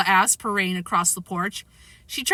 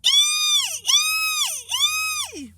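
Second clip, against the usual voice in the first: string of very high squeals; repeated shrieks; much slower than usual. A narrator's reading. Tootsie joining the breakfast uproar, shrieking. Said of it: "Tootsie shrieked".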